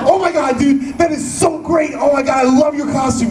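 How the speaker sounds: high, starstruck gush; hammed-up high-pitched compliment; gushing excitement. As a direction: gush like an overexcited fan, acted out. Retold gushing to costumed fan.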